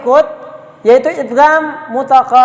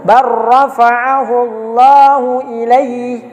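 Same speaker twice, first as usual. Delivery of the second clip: slow chanted tajwid recitation; measured Quranic chant; slower than usual. Shown as a demonstration. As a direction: recite it melodically and slowly. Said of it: "cara membacanya saja seperti ini".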